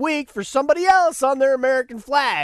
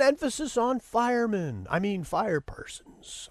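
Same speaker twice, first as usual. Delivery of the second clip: usual speaking voice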